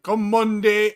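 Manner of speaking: mock the title in a Jamaican lilt; Jamaican accent. Telling the co-host what it sounded like, Jamaican accent. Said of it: "it sounded Jamaican".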